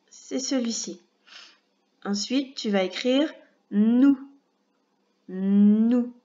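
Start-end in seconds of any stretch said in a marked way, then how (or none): 3.7-6.1 syllabe dictée, bien détachée; voix de dictée. Shown as a demonstration. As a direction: articule comme pour une dictée à l'école. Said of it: "faire une petite dictée de syllabes". (la maîtresse dicte une syllabe à l'élève, bien articulée)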